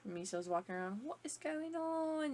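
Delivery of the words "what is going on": slow, high British voice; drawn-out posh British; British accent. Retold or imitated. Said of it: "slip into British".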